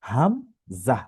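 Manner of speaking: হামজা said clearly after হা; Hamza contrasted with Ha. Shown as a demonstration. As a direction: mark হামজা as distinct letter. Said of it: "হা, হামজা".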